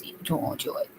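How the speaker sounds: voiceless murmur to the dog; whisper. Real, not acted. Owner whispering to her reluctant dog. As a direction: whisper it under your breath.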